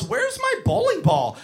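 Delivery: put-on high, whiny child voice; impatient kid. Kid with head in ball return, whining. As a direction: imitate a clueless kid demanding his turn.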